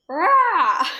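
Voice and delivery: loud high roar; lion roar. Pretend. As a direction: let out a big lion roar. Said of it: "give me a roar".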